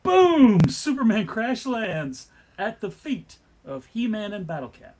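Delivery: usual speaking voice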